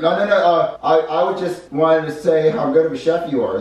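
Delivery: high, loud, hurried-sounding excuse; flustered flattery. Shown as a demonstration. Junior distracts the chef with praise, flustered. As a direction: cover up with gushing praise. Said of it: "and then I'm like".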